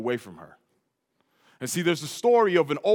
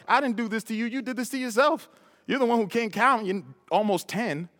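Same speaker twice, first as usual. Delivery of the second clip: inner scolding; 7 semitones higher than usual. Retold or imitated. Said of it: "I think to myself".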